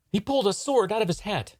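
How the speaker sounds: high-pitched theatrical alarm. Acted. Onlooker watching magician draw a sword, alarmed.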